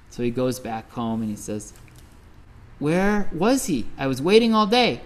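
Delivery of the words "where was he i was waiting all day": annoyed complaint. Retold or imitated. Angry hunter complains.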